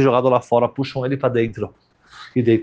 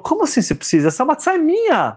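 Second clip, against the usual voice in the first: indignant refusal. Acted. Yehudi refuses to give matzah.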